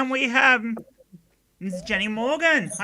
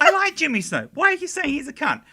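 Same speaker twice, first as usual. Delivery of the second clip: higher-pitched protesting fan, lightly voiced; imitated objecting viewer; 7 semitones higher than usual. Host mimicking people who will watch this, lightly voiced protest. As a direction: put on a mildly indignant fan.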